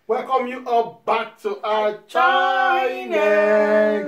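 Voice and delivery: loud, high, drawn-out singsong greeting; hyped YouTuber intro. Acted. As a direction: belt the welcome like a show host.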